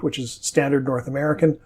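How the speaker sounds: normal talking voice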